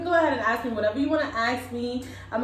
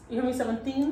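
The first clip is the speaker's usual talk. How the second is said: put-on Spanish accent. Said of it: "That's my Spanish accent".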